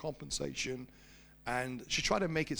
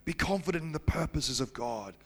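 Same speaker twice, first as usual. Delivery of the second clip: sermon appeal; 4 semitones lower than usual. Acted. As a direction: drive the point home to the church. Applies story to church.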